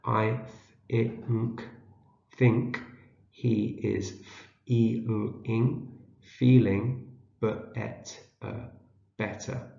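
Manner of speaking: slow, halting word-by-word decoding; sounding out. Shown as a demonstration. Teacher helps learners decode a short sentence, sounding out. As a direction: split each word into sounds, then say it whole. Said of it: "see if we can read it".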